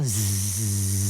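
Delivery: long, low, soft buzzed zzz; mock snooze. A narrator's reading. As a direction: buzz off into sleep. Pupil dozes off during lecture.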